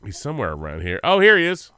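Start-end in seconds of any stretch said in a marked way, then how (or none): 1.0-1.7 bright and loud, high up; triumphant discovery; excited find. Real, not acted. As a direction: pounce on it like you found treasure. Finds the clip, excited.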